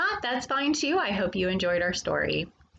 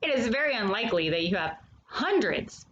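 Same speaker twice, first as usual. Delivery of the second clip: lively, sing-song picture book reading; reading to children. Acted. Storyteller reading a bee picture book to young children, animated and sing-song. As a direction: read it to the little ones like a storyteller.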